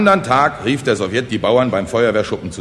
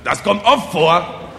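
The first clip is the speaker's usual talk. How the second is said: slow, high, emphatic assurance; proud conviction; 8 semitones higher, 4 dB louder, slower than usual. Acted. Speaker assures the frightened peasants, proud conviction. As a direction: state it proudly, weighing each word. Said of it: "stolz im Brustton der Überzeugung".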